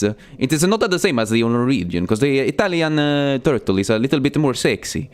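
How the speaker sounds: put-on comic Italian accent; Italian turtle accent. Turtle comparing himself with Norwegians, mock Italian accent. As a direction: play up a silly Italian accent. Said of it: "Italian turtle be like".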